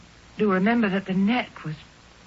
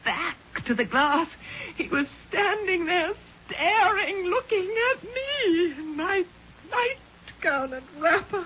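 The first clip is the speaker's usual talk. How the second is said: distraught recounting; 7 semitones higher than usual. Acted. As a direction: relive the fright, drawing out the words. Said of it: "please try to remain calm".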